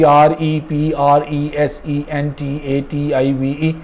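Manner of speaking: the wrong way: slow rote chain of letters; rote letter-by-letter spelling. Shown as a demonstration. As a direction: spell it out slowly, one letter after another, no grouping. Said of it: "अगर आप यूँ याद करेंगे जी".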